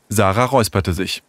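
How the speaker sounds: ordinary delivery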